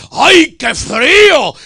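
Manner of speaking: high, loud, very slow "ay, qué frío"; shivering cry of cold. Pretend. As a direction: cry out at the sudden cold, drawn out. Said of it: "uno dice".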